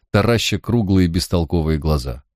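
ordinary delivery

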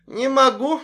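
put-on high loud drunken refusal; drunk defiance. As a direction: shout it back, drunk and defiant. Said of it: "с пьяным вызовом". (с пьяным вызовом)

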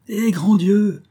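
high-pitched exasperated exclamation; crying out. A narrator's reading. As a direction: burst out, pitch leaping up. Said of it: "s'écria-t-il". (exclamation aiguë et exaspérée)

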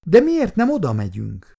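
put-on high, inquisitive little-girl voice; curious Olenka. A narrator's reading. Little girl presses the old man, high and curious. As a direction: ask it high, curious like a child. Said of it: "kíváncsiskodott tovább Olenka".

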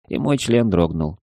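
plain reading voice

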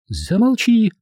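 high-pitched woman's retort; sudden high outburst; flaring up. A narrator's reading. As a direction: cut him off in a high, indignant voice. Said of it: "вскинулась Эдвина".

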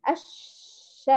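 long hanging sh on الش; overstretched shin. Shown as a demonstration. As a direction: drag the ش of الش far too long. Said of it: "ونمطط الشين الأولى".

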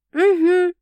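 an absent, mildly distant uh-huh; half listening, busy cooking; detached. A narrator's reading. Sasha answering absently while busy with toast. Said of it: "отстранённо ответила".

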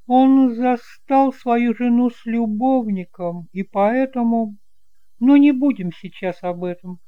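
hint at scandal, then turn sweet and curious; aunt Eloi, gossiping. Acted. Aunt changes the subject.

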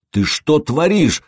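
slow, high-pitched strained protest; a touch of choking in a drawn-out demand; choked rasp. A narrator's reading. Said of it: "захрипел тот". (сдавленно, с хрипом)

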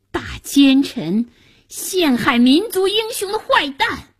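high-pitched indignant denunciation; furious boy's curse. A narrator's reading. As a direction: hurl the accusation like an outraged child. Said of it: "夏楠气急了".

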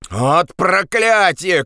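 high, drawn-out exasperated cry; shouting. Acted. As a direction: curse aloud, stretched and high. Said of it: "крикнул Андрес". (досадливый крик)

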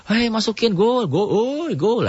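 high, slow mock cheering for a goal; hammed-up stadium yell; football fan shouting. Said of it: "Kayak orang yang lagi main bola".

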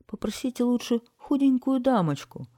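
high plaintive pleading; unhappy. A narrator's reading. Garbage man tells citizens he cannot help, unhappy pleading. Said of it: "сказал несчастный мусорщик".

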